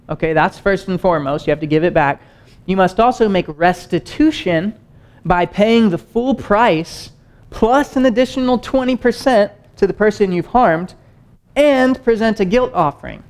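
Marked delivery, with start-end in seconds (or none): 2.7-13.2 reading Leviticus, raised and unhurried; scripture reading. Acted. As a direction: lift the voice to read the verse out. Reads on in Leviticus.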